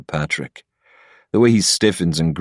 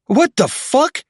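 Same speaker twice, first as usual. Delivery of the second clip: shocked, much higher sputter; slower than usual. A narrator's reading. Patrick recoiling from the beer cup, sputtering in shock. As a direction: recoil in disgust. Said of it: "Patrick sputters".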